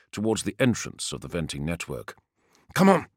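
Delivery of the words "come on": calling out. Acted. Urging comrades forward.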